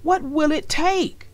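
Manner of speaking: put-on boy giving in; boy sighing okay to sister. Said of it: "said Arthur".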